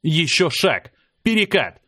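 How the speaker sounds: mild shouted commands, rising in pitch; restrained battle cry in short bursts. A narrator's reading. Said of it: "вылетающая из рта вместе с криком".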